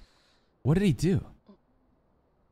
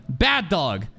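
ordinary talk, nothing marked

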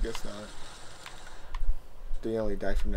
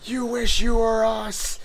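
mock taunt; 10 semitones higher, 4 dB louder than usual. Taunting enemy in game.

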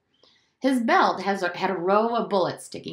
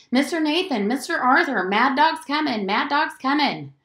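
loud raised alarm call; shouting a warning; 7 semitones higher, 5 dB louder than usual. A narrator's reading. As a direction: bang on the door and cry out.

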